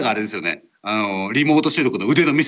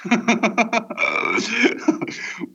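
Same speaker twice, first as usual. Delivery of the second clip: long, high chuckle; real laugh; 8 semitones higher than usual. Real, not acted. Host reacting to co-host's delay trouble, laughing. Amused by the huge audio delay.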